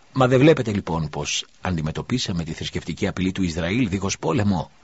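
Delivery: plain reading voice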